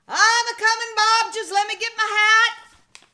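high, loud hurried call; sheriff shouting back. Acted. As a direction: holler back while grabbing the hat. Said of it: "and he said".

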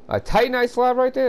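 high squeaky disbelieving question; incredulous surprise. Real, not acted. Gamer spotting loot in the chest, high incredulous question.

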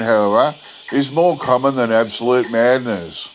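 ordinary delivery, nothing marked

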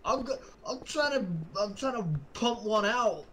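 high, indignant Bronx complaint; Bronx accent monster. Acted. Monster interrupted in private, comic Bronx accent. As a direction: protest in a put-on Bronx accent. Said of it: "spoke in, like, Bronx accents".

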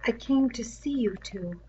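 quiet strained plea; throat hurting. Acted. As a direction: let the hurt in the throat come through quietly. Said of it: "His throat was hurting him now".

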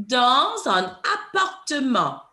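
slow, deliberate French accent for the learners. Shown as a demonstration. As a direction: model it slowly in a careful French accent. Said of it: "Put your French accent on".

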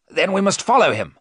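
high, loud, urgent call to action; urgent cry. Acted. Arthur urges the men to follow Dracula, crying out. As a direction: cry out, eager to give chase. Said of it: "Arthur cried".

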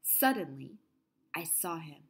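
hushed, breathy whisper for suspense; whispered reveal. A narrator's reading. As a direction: drop to a whisper as he appears. Suspense in the story.

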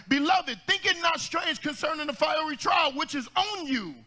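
preaching at full force. Real, not acted.

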